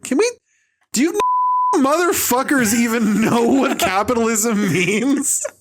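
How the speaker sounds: high, drawn-out laughing disbelief; exasperated laughing. Real, not acted. Cracking up in exasperation at capitalism rant.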